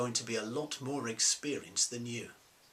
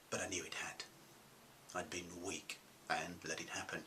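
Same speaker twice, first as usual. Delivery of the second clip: much softer, lower admission; quiet confession; 3 semitones lower, 13 dB quieter than usual. Acted. Disgraced businessman admitting he was influenced, hushed confession. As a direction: drop right down and own up to it. Confesses he had been weak.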